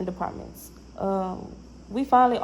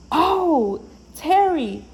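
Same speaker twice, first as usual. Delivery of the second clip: high, bright realization of who it was; light up at the name; excited recognition; 8 semitones higher, 3 dB louder, much slower than usual. Retold or imitated.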